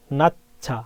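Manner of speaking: "নাচ্চা" with a puffed ch on the second syllable; aspirated ch. Shown as a demonstration. Teacher models the chieut rule for student, aspirated ch. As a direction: say "নাচ্চা" as two beats, nat-chha. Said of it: "চিয়তের মতো উচ্চারণ হয়ে যাবে".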